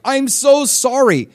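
high, slow, loud fake apology; mock sorry. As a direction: ham up a showy apology. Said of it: "a show of mourning".

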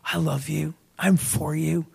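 very soft, low, heartfelt assurance; almost murmur it, father to child; tender father. Acted. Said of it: "to hear from their father".

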